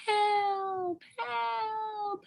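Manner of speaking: high, thin calls for help; a small child calling from far inside a cave; tiny baby cry. Acted. Baby cries from dragon's cave.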